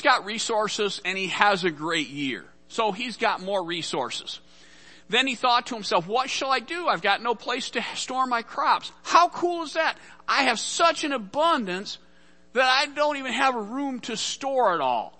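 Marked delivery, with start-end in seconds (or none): 6.1-15.1 gleeful rich man. Acted. Rich man gloats over harvest.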